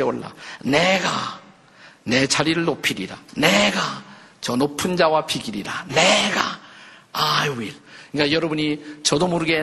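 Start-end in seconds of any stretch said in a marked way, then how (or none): none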